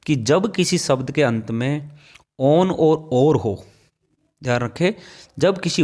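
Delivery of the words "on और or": on और or, each said very slowly; on और or बहुत धीरे, अलग-अलग; very slow spelled endings. Shown as a demonstration. (on और or बहुत धीमे, साफ़ साफ़)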